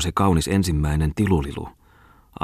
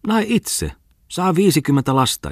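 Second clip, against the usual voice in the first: much higher mocking squeal; jeering; 11 semitones higher than usual. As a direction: squeak it out as a jeer. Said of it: "ilkkui Muttisen Aapeli". (kimeä pilkallinen ilkkuminen)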